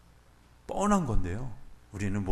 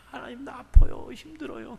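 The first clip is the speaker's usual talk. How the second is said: pleading in pain; 13 semitones higher, 7 dB louder than usual. Retold or imitated. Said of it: "그렇게 이야기하는데".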